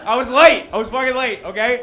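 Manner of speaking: high, loud laughing protest; defensive outburst. Acted. Comic excusing himself for hitting a man, defensive outburst. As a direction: blurt the excuse loudly.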